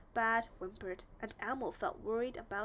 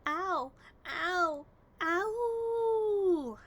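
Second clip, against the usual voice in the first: long rising howls of pain, louder; wolf howling ow; 8 semitones higher, 9 dB louder, much slower than usual. A narrator's reading. Wolf howls in pain to the dentist: loud howling. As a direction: howl each ow like a hurting wolf. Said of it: "howled the big bad wolf".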